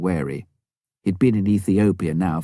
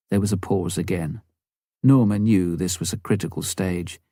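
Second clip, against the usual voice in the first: plain reading voice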